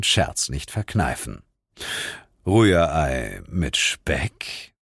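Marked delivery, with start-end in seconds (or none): 2.5-4.7 deadpan joke. Acted.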